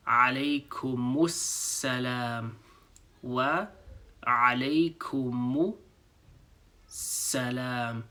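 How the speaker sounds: Alaykumussalam drawn out slowly, syllable by syllable; slow demo. Shown as a demonstration. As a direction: spell Wa alaykumussalam out slowly for learners. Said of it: "Now we're saying".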